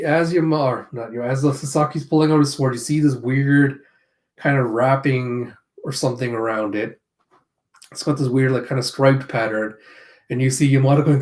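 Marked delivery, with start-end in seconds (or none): none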